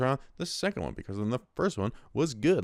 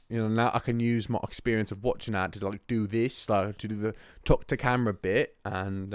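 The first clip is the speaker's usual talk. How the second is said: ordinary delivery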